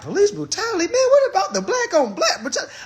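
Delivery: very high, squeaky disbelief; incredulous retort. Retold or imitated. Him answering folks about police brutality, incredulous retort. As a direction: throw it back way up high, incredulous. His incredulous reply to them.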